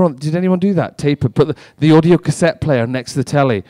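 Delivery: ordinary delivery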